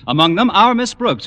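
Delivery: normal talking voice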